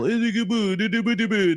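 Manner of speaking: high sing-song "boo-do" noise; mock nonsense babble. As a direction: mimic an over-the-top accent as high gibberish. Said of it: "I don't do the whole".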